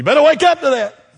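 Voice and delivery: high, loud wake-up call; sudden shout. Real, not acted. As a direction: jolt them awake. Preacher shouts warning after hush.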